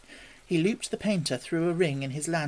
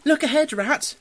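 high, loud warning cry; sudden cry; 11 semitones higher, 5 dB louder than usual. A narrator's reading. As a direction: burst out with an urgent alarm. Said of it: "cried the mole suddenly".

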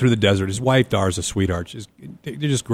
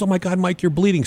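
higher-pitched mock cry of alarm; retold alarmed yell; 7 semitones higher than usual. Neighbor's wife sees his bleeding leg, mock alarmed yell. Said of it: "so Dar's yelling".

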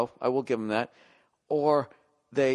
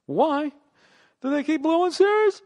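much higher and louder, yelling the question; exasperated shout; 13 semitones higher, 4 dB louder than usual. Real, not acted. Fan ranting at his team for blowing series, shouting high. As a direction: yell it at the heavens in disbelief.

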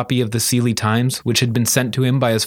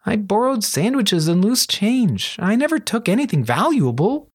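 raised-pitch protest in character; Kuro, defensive; 9 semitones higher than usual. Acted. Thief playing down his crimes to a friend, high defensive voice.

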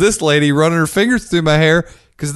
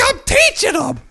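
much higher, squeaky mock voice; mock teacher falsetto. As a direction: squeak out her innocent excuse. Mocking the teacher's excuse.

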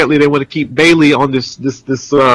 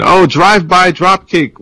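higher, drawn-out mock match call; excited play-by-play; 6 semitones higher than usual. Host calls an imagined match, excited play-by-play. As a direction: call the move like a thrilled commentator.

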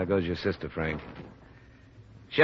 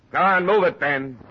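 calling out; 8 semitones higher, 5 dB louder than usual. Acted. Urging horse onward, calling out.

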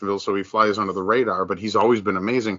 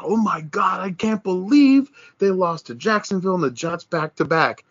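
mock shock; 7 semitones higher than usual. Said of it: "goes like".

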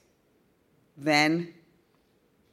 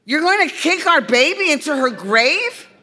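much higher, louder incredulous cry; outraged; 9 semitones higher, 9 dB louder than usual. A narrator's reading. Girl appalled at her friend's plan, loud outraged cry. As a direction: protest in shock.

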